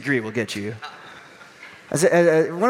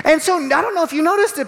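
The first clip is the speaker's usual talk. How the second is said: normal talking voice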